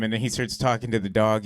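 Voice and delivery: ordinary talk, nothing marked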